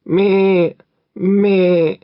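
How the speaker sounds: high, loud bleat; goat bleating. Acted. The goat bleats to frighten the robbers, high loud bleat. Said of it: "and the goat bleated".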